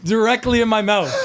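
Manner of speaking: high, loud, breaking into laughter; can barely get it out through laughs; squealing with real laughter; laughing. Real, not acted. Laughing at an embarrassing fart story.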